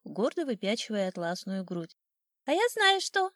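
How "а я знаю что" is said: high, loud sparrow chirp; chirping old sparrow. A narrator's reading. Old sparrow needling the rooster from the willow, high chirping. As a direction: pipe it up high like a cocky little bird. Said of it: "чирикнул с вербы старый воробей".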